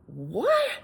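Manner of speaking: high-pitched disbelieving what; baffled reaction. Retold or imitated. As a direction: blurt it out in pained disbelief. Reacting to sudden bad pain.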